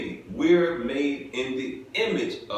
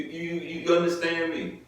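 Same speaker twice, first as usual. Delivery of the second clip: ordinary talk, nothing marked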